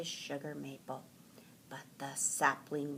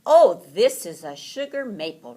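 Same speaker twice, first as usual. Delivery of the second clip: Mister Heron's reply; 12 dB louder than usual. A narrator's reading. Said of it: "replied Mister Heron".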